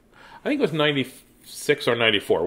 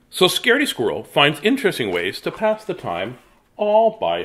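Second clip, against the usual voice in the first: storytime read-aloud with dramatic pauses; reading to children; 3 semitones higher than usual. Acted. Reads picture book aloud.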